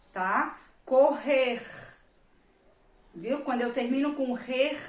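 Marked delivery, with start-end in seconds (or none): 0.9-1.6 "Correr" slowly, with a long rasping R at the end; throaty final R. Shown as a demonstration. Teacher asking pupils to notice the sound, throaty final R. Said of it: "prestar atenção no som". 4.3-4.8 "rer" with a long throaty final R; throat-tickling R. Shown as a demonstration. Teacher showing how the word ends, throat-tickling R. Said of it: "sonzinho que dá cosquinha na garganta".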